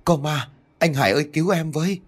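high-pitched cry for help; terrified cry. A narrator's reading. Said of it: "rồi hét toáng lên". (hét toáng cầu cứu)

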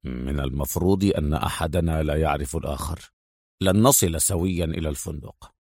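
low, soft, confidential instruction; hushed male secret agent; whispering in her ear. A narrator's reading. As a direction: murmur deep and discreet, still voiced. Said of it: "همس أدهم في أذن منى".

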